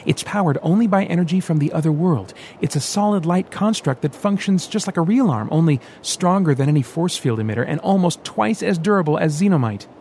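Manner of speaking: eager, tumbling enthusiasm; excited explanation. Acted. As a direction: show off the new toy. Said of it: "he explained excitedly".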